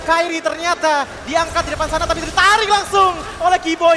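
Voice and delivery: loud high shouted commentary; hyped caster. Real, not acted. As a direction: ride the momentum, shout it. Co-caster hyped after objective.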